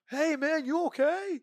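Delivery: high, loud call to a stranger ahead; loud friendly hail from behind. Retold or imitated. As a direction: shout it out to get his attention. Calling out to stranger on road.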